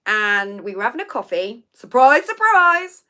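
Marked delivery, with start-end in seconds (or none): 1.7-3.0 loud sing-song surprise surprise; mock surprise. Real, not acted. Teasing viewers about being recognized, loud and mocking. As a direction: ham up the irony. Sarcastic aside about fame.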